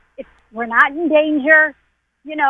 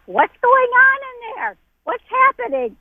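high-pitched mock yell at the TV; high, stretched-out cry of alarm, retold; imitating own yelling; 10 semitones higher than usual. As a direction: reenact your panicked yelling, voice way up without much extra volume. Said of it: "when I start screaming and yelling".

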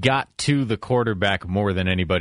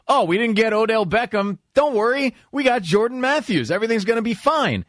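mock fan voice; 9 semitones higher than usual. Mocking a fan's false comfort.

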